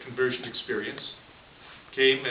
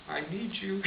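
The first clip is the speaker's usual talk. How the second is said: soft, higher-pitched appeal; coaxing plea; 6 semitones higher, 5 dB quieter than usual. Retold or imitated. Nun asking the bartender for help, soft coaxing. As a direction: quietly work on him for a favour.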